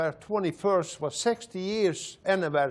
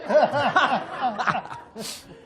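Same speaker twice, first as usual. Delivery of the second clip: loud, high burst of laughter; 7 semitones higher, 4 dB louder than usual. Real, not acted. Laughing loudly while telling the audience about Texas. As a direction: laugh hard at your own story.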